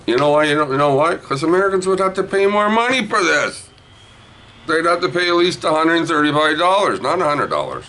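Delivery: high, insistent salesman rant; excited, high-pitched justification; worked-up explanation. Real, not acted. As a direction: push it up high as you make the point. Worked-up pitch about American prices.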